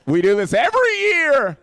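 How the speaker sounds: loud, high, exasperated protest; exasperated yell. Retold or imitated. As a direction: shout it in comic disbelief. Exasperated reply to mourning mother.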